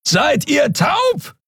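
high, slow bellow across distance. A narrator's reading. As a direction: roar it over the gap. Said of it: "brüllte Keru".

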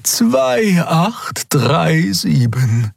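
very slow, high, drawn-out digits; high, unhurried, patient voice; patient correction. A narrator's reading. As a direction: correct gently, stretching every number. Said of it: "korrigierte Xea geduldig".

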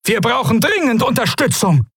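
high, urgent plea for backup; calling out. A narrator's reading. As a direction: call desperately needed help from the fleet. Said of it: "rief er".

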